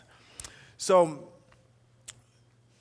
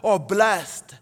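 loud, raised, driving sermon delivery; full-force preaching; 5 semitones higher, 9 dB louder than usual. Acted. Preacher telling the church how the psalmist loves God's law, full-force preaching. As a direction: preach it forcefully, punching the key words.

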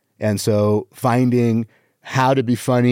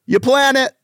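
very high, squeaky comic pitch; exaggerated punchline; 13 semitones higher than usual. Shown as a demonstration. Comic working on his delivery, exaggerated high punchline. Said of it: "on the delivery".

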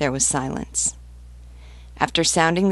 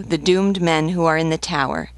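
plain reading voice

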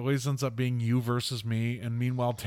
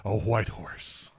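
mock scripture reading; much slower than usual. Friend hams up Revelation about end times, grave and hushed. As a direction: intone it like an end-times preacher.

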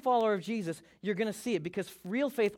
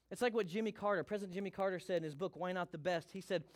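ordinary talk, nothing marked

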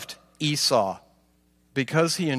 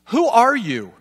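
raised, mildly startled question; puzzled question; 6 semitones higher than usual. Acted. Father asking who has just come in, mildly startled. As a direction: ask it higher, as if taken aback. Blind Isaac questions the newcomer.

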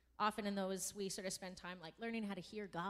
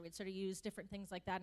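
ordinary delivery

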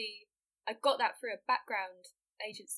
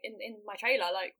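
ordinary delivery

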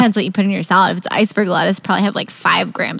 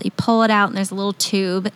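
ordinary delivery

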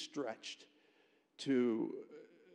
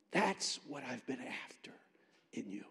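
hushed whisper. Retold or imitated. The Lord speaking to a student, hushed whisper. The Lord's words, turning intimate.

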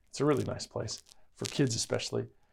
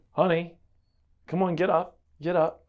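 mom calling a bit louder, a touch impatient; a little louder; 7 semitones higher, 7 dB louder than usual. Retold or imitated. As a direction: raise the voice to wake the sleepy kid. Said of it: "a little louder".

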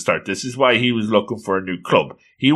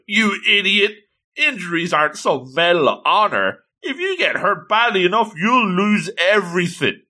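higher-pitched, hammed-up scolding boy; mock angry scolding; 7 semitones higher than usual. Sakurai scolding Toyoguchi about her injuries, mock angry. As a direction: scold her like a worked-up teammate, mildly. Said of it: "he loses it".

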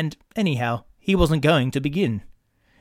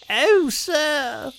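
much higher put-on old woman's voice; plaintive washerwoman; 13 semitones higher than usual. Toad in disguise pleading with the engine driver. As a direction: wail it like a poor old washerwoman. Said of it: "cried Toad".

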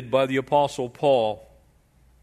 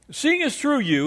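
high, grand fawning orator; butter up the judge with a lofty high voice; flattering orator; 11 semitones higher than usual. Acted. Preacher voicing flattering lawyer.

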